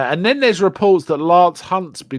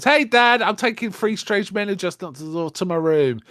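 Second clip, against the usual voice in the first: higher-pitched imitation of a boy announcing guests; boy calling Dad; 5 semitones higher than usual. Boy telling Dad about strange guests, mock calling out. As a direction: call to Dad, lightly acted.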